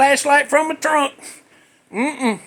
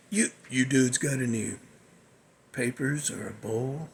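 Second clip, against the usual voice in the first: much lower, softer stoner voice; stoner buddy; 13 semitones lower, 12 dB quieter than usual. Retold or imitated. Buddy in the car asking the dudes for papers, in a much lower, softer voice. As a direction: drop way down into the weed-hunting buddy.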